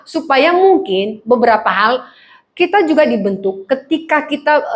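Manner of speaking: normal talking voice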